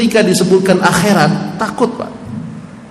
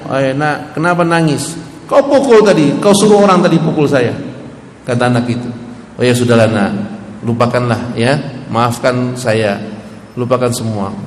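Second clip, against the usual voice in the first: put-on soothing old imam; 7 semitones lower than usual. Imam Malik voiced soothing boy. (imam membujuk anak kecil, suara rendah dan lembut)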